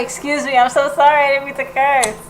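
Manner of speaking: ordinary talk, nothing marked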